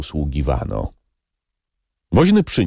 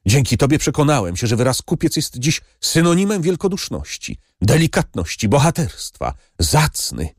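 exclaimed; 7 semitones higher than usual. A narrator's reading. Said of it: "zawołał pan Tomasz".

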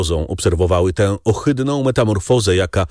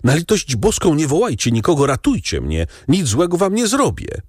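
pleading with raised pitch, half-dazed; frantic pleading; 7 semitones higher than usual. A narrator's reading. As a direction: beg them not to call anyone. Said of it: "zawołał blady i półprzytomny, nie widząc nawet". (błagalnie, w panice)

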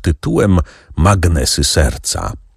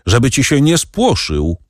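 higher-pitched instructions, mildly called; lightly raised calling voice; 7 semitones higher than usual. A narrator's reading. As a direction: call directions to the boy without shouting. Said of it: "Andrzej zawołał".

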